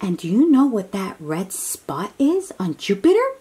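ordinary talk

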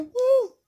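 high-pitched woo; short high whoop of celebration; cheering woo. Pretend. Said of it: "Let's cheer".

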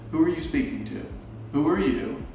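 normal talking voice

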